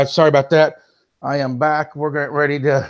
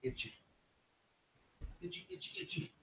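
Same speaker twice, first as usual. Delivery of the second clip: whispering; 22 dB quieter than usual. Real, not acted. Muttering to himself off mic.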